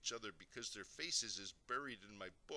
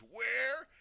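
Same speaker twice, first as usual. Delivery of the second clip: very high, drawn-out loud question; bewildered shout; 13 semitones higher, 14 dB louder than usual. A narrator's reading. Daddy growing frantic for his invisible son, shouting. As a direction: stretch it into a baffled shout. Father's search escalates.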